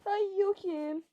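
startled. Acted. Startled by the prank.

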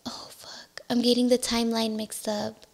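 whispered to herself, caught in a mistake; hushed self-correction mid-story; whispered aside. Real, not acted. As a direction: drop to a whisper, annoyed at yourself.

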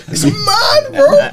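high, slow cry of disbelief; high wailing it's mad; incredulous. Real, not acted. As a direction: stretch it out in amazement. Disbelief at Ferguson story.

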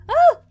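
sudden high scream of fright; startled scream. Real, not acted. Scared player screaming at a jump scare.

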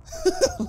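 short, very high giggle; amused giggle. Real, not acted. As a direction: giggle at a silly sight. Laughs at character's arms.

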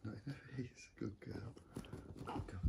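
whispered warning then praise; hushed whisper. Real, not acted. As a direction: keep it barely audible. Hushing near the sleeping baby.

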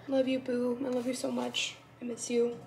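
soft, heartfelt affection; tender. Real, not acted. Speaking to her faraway bestie after reading the card, tenderly. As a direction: say it softly, missing them. Touched, missing faraway best friend.

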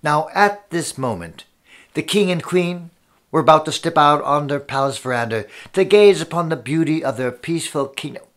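usual speaking voice

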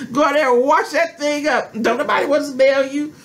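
put-on bossing her girl about hygiene; scolding mother. Mother lecturing her daughter on washing, a hint of a scolding mother. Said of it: "I remember my mother coming".

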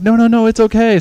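high, loud protesting voice; acted-out flustered intervention; urgent reassurance, imitated. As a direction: jump in to defend him. Lady hurriedly calms the bystander.

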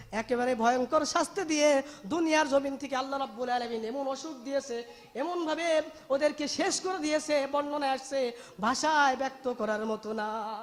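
usual speaking voice

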